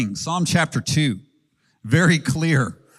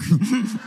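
tiny high-pitched "rawr"; baby lion roar; 9 semitones higher than usual. Retold or imitated. Little daughter playing the baby lion, high little roar. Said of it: "What does the baby lion say".

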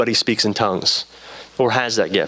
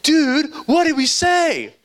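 high, drawn-out incredulous question; bewildered urgency; 14 semitones higher, slower than usual. Retold or imitated. Praying friend asking weeping Adam what was said, bewildered urgency. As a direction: imitate a bewildered buddy begging to know, pitch way up.

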